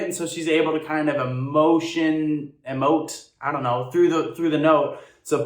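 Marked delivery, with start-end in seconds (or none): none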